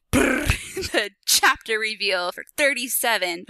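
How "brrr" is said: drum roll. Pretend. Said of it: "drum roll, please".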